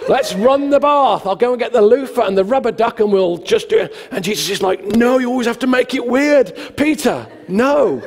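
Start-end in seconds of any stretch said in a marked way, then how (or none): 0.1-3.9 high, loud, hammed-up enthusiasm; mock excited gushing; over-eager. Peter carried away, played for laughs. 5.0-8.0 high-pitched comic exasperation; hammed-up fed-up objection; exasperated. Jesus stopping over-eager Peter, comic.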